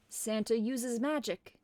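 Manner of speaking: ordinary delivery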